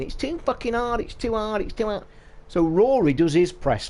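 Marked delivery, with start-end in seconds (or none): 0.0-2.0 high, whiny mimic of moaning pros; mocking complainers, voice pitched high; mock whining. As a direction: whinge it like a spoiled pro. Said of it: "they're all bitching and moaning".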